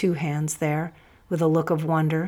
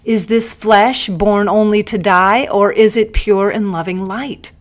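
intense loud challenge; 4 semitones higher, 10 dB louder than usual. A narrator's reading. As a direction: slam each question home. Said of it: "I leaned over intensely".

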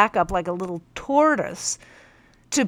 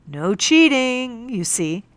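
higher, louder and slow No cheating; proud exclamation; 5 semitones higher, 5 dB louder, slower than usual. Acted. Captain proudly showing the teacher his straight legs, exclaiming. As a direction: show off his correct pose, pleased. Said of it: "he exclaimed".

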